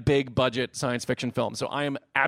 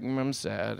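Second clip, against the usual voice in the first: low, soft mopey grumble; mock-sad murmur; mopey mobster; 5 semitones lower, 7 dB quieter than usual. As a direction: mumble it glumly, mock sorrow. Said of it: "being like".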